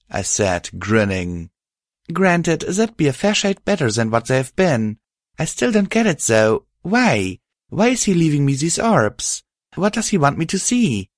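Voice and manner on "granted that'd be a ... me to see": put-on quick, higher young mare voice; brisk, eager heroine; lightly voiced young mare.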